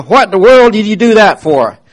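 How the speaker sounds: loud, high call out the car window; hollering at the young man; yelled. Retold or imitated. Woman in Cadillac yells at young driver, loud high call. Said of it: "she yelled out her window".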